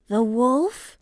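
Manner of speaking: little ostrich hearing danger, shocked; shocked question. A narrator's reading. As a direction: catch your breath in shock. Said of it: "asked in a shocked voice".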